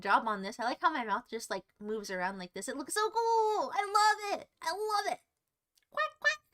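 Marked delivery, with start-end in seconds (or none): 2.7-5.2 high-pitched gushing; delighted, lifted voice. Real, not acted. Gushing over artist's work. 5.9-6.4 very high little quacks; short, high mock duck calls; duck imitation.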